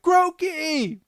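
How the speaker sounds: high, loud mock cartoon cry; calling out. Podcaster acting out characters calling to Groki, high cry.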